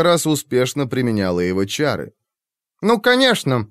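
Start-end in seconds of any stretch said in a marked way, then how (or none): 2.8-3.7 talking through a mouthful; chewing while speaking; mouth full. A narrator's reading. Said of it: "с набитым ртом". (с набитым ртом)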